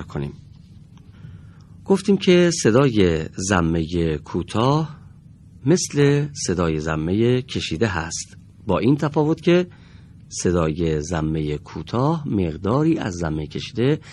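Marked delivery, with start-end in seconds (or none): none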